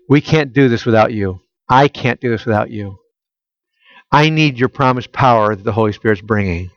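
imagined disciples' prayer. Retold or imitated. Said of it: "I suspect they were praying".

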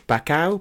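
"Baku" ending in "ow", rising in question; "Baku" said back-ow with a questioning lift; questioned pronunciation. Shown as a demonstration. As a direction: try "Baku" as back-OW, unsure. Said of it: "complaining about my pronunciation".

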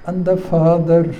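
ordinary delivery

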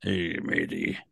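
low, soft pirate greeting; mock pirate. Host tags the bit, low mock pirate voice. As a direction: mutter it as a cartoon pirate. Playing pirate after accent joke.

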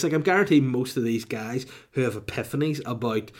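usual speaking voice

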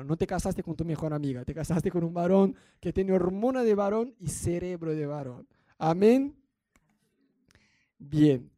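usual speaking voice

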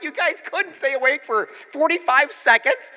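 high, loud, incredulous scolding; exasperated rebuke. Retold or imitated. Jesus scolding the sleeping disciples, exasperated rebuke.

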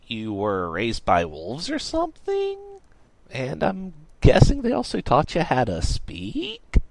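incredulous questioning, rising; sceptical. A narrator's reading. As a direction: draw out the question in disbelief.

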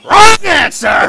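slow, high, loud villain yell; villain shout. Retold or imitated. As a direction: bellow it like a cartoon villain.

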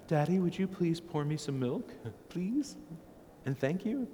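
high-pitched, mock-sweet little kid; imagined polite child. Four-year-old asking daddy for milk, exaggeratedly polite. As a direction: ask ever so politely, lifting each phrase up high. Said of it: "my four-year-old just can't find it in himself to say".

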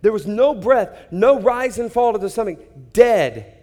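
high, loud, breathless wonder; elated retelling of a miracle; amazed exclaim. Retold or imitated. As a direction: shout in amazed disbelief. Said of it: "I'd be like".

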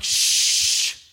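sharp loud shush. Acted. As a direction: hush him hard. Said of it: "They're like".

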